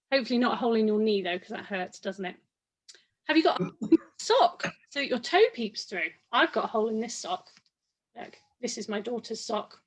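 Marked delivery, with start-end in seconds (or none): none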